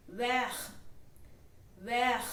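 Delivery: vert as "vair", final t silent. Shown as a demonstration. Teacher modelling vert with a silent t. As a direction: cut vert off before the t. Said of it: "The T in the end will not be pronounced".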